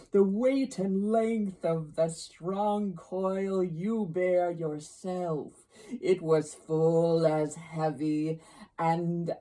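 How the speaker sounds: loud ghost voice with stretched words; solemn ghost. Acted. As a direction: pronounce it weightily as a haunting spirit. Ghost's speech in narrated story.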